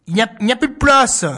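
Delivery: firm refusal. Acted. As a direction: put your foot down.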